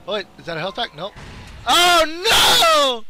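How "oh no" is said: high, loud drawn-out wail; dismayed scream. Real, not acted. Gamer losing his quarry, dismayed scream.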